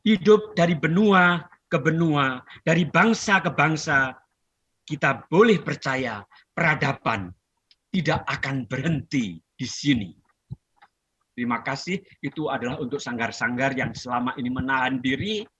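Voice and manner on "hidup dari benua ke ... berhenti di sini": dramatic recital, phrases lifted and then falling away; stately, heartfelt poem reading; poetry recitation. Acted. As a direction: declaim a poem line by line with long pauses. Recites own poem to audience.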